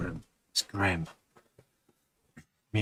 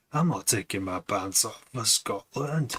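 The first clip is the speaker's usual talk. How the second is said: put-on slow, amateur Scottish accent; bad Scottish accent; 2 semitones higher than usual. Streamer quoting a movie line, bad Scottish accent. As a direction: attempt a Scottish accent, drawn out. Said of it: "I can't do a Scottish accent".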